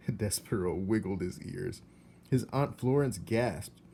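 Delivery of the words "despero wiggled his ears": ordinary delivery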